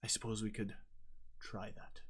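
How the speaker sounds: soft unvoiced whisper; whispered aside. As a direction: let the last words slip out under your breath. GM mutters in character.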